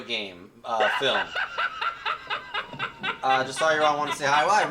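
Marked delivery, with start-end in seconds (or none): 1.3-3.2 high giggle. Real, not acted. Laughs at own slip.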